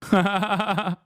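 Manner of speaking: a high, delighted burst of laughter; short high cackle of delight. Real, not acted. Laughing at chat.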